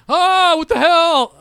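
high, loud, slow shocked yell; retold alarmed shout; startled yell. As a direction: yell on finding a stranger in the shed. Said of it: "I was like".

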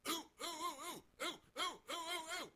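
very high, rapid excited ooh ooh ooh. Pretend. Announcer nudging his partner on air, very high excited ooh. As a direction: squeal like you just saw the play coming. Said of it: "just say on air".

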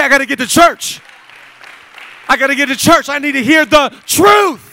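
preacher shouting about the church's calling; full-force preaching. Acted. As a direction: proclaim it to the whole room.

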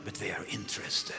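soft intimate whisper. Real, not acted. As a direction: draw them in with a hush. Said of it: "preacher drops to whisper".

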